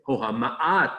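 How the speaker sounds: a scornful, mocking "Ho hama'at"; contemptuous. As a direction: sneer it like a mock question. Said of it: "they contemptuously say".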